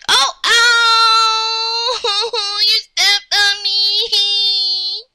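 high, long drawn-out howl of hurt; hammed-up crying complaint; pained wail. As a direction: wail slowly as if stepped on. Stepped on, wailing in pain.

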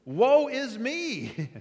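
high, loud, slow wail; anguished cry. Acted. Isaiah overwhelmed before God, anguished cry. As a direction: cry out in dread. Imagined reaction of dread.

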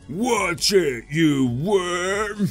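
villain's gruff warning; Team Rocket. Acted. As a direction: snap it like a cartoon thug. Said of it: "That's Team Rocket's voice right there".

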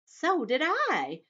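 high, loud ladybug voice; eager ladybug. Acted. Ladybug chiming in after earthworm, high and slow.

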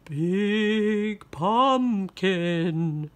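slow, drawn-out ghost. Acted. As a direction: moan it like a ghost. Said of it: "said the ghost".

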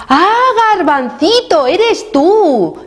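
high, delighted-sounding storybook surprise; surprised recognition. A narrator's reading. Shopkeeper finally finds Garbancito, high surprised exclamation. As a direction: exclaim high as you spot who it is.

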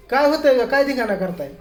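half-laughing pretend telling-off; mock scolding.

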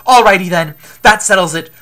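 loud, hearty rallying cry; with gusto. Acted. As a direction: set off with loud enthusiasm. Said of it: "exclaimed Apollo with gusto".

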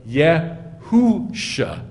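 very slow "Yehusha", split yeh, hoo, shah; misread hoo. Shown as a demonstration. Teacher showing how Gentiles read the letters, very slow "Yehusha". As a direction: sound out "Yehusha" with long oo in the middle. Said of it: "they just read the vowel".

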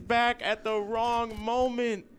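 agonized caster's call; anguished play-by-play. Real, not acted. Caster reacts to fatal error.

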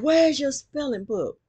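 teacher's loud stern question; mock stern teacher demand. Mean teacher demanding the lost spelling book, loud and stern. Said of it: "She said".

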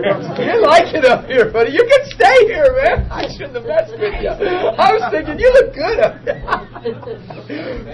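high, slow, laughing through the words; can't stop laughing while teasing; real giggling fit. Real, not acted.

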